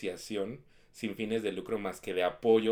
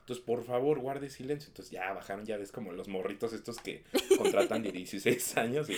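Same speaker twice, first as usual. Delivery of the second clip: ordinary talk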